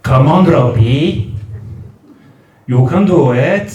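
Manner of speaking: put-on broad sing-song Newport Welsh accent. Granny Gail coaxing baby Robbie to walk, Newport accent. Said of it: "the accent".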